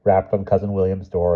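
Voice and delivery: plain reading voice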